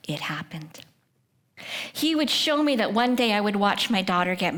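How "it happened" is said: breathed, voiceless and soft; awed whisper. Real, not acted. Speaker tells the audience the promise came true, whispered. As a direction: hush it like a small miracle. Quiet awe at answered prayer.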